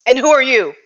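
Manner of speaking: loud, demanding question; police chief's challenge. Acted. Police chief steps over to challenge the stranger, loud demand. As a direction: confront the stranger loudly. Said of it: "steps over to you and goes".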